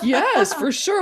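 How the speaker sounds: high, loud laughing agreement; laughing yes. Retold or imitated. Friend agreeing to be her bridge angel, laughing yes. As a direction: laugh while promising. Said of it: "she's like".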